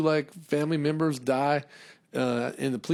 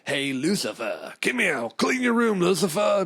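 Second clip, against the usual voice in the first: higher, louder mock parent calling; 7 semitones higher, 4 dB louder than usual. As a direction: call the kid to clean up. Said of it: "you can be like".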